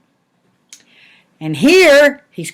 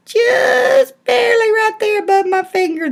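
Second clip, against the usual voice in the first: high excited squeal; 13 semitones higher than usual. Real, not acted. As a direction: point it out with delight. Excited pointing out sprout.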